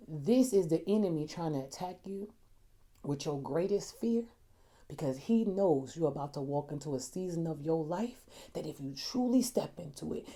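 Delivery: low, hushed voice of a praying grandmother, retold; quiet, low prophetic reassurance; grave encouragement. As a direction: speak softly and gravely, letting each phrase land.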